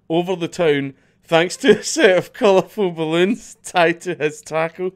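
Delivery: laughing through every phrase; cracking up mid-line. Real, not acted. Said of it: "why is this so funny".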